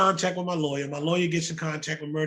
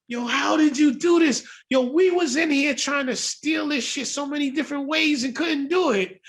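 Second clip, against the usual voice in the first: animated outburst; 8 semitones higher than usual. Retold or imitated. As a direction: hype him up like Irv marvelling at the theft. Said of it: "He goes through all this animated shit".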